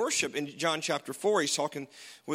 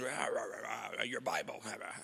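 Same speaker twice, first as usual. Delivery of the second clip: mocking babble; 8 semitones lower, 10 dB quieter than usual. Mocking critics of the faith.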